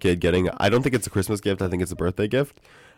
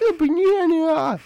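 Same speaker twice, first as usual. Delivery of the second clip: put-on little kid voice; 3 dB louder, slower than usual. Re-enacting the kid's delight.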